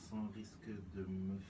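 ordinary talk, nothing marked

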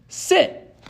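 very high, loud single-word order; called command. A narrator's reading. Girl to the three-headed dog, calling out. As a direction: call it out to a dog. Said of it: "Annabeth called again".

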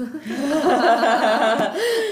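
bursting into loud giggles; loud delighted giggling; giggle fit. Real, not acted. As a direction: laugh out loud, giggling hard. Giggling at the quote.

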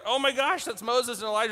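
high amazed gasp of recognition; amazed disciples. Retold or imitated. Disciples spotting Moses and Elijah, high and amazed. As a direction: gush like you just saw a legend. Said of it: "they're like".

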